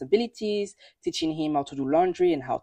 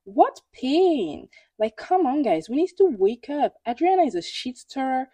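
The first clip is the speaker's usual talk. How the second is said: exasperated outburst; 8 semitones higher, 3 dB louder than usual. Real, not acted. Exasperated rant at viewers.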